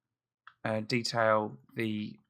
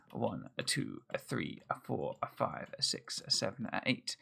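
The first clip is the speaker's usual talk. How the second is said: counting under breath; 4 semitones lower, 7 dB quieter than usual. Real, not acted.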